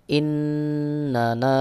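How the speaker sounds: ইন্নানা drawn out with nasal hum; slow recitation. Shown as a demonstration. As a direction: recite ইন্নানা slowly, nasal ন্ন held. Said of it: "নুন আলিফ জবর না". (ধীরে ইন্নানা)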